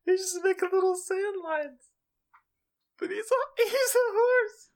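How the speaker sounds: very high, squeaky laughing disbelief. Real, not acted. Friend cracking up picturing a horse raking sand, laughing high. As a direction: crack up as you say it.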